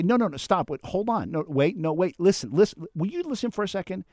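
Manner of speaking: high, insistent cutting in; firm interrupting. Shown as a demonstration. Introvert standing up to a dominant talker, insistent interruption. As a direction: stop them mid-sentence, firmly. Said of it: "And you gotta say".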